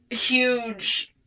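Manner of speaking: usual speaking voice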